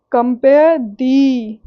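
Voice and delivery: compare thee, very slow, thee held and stressed. Shown as a demonstration. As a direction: lean long on thee after compare. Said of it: "thee पे ज़्यादा focus जाएगा". (compare thee में thee पर लंबा ज़ोर)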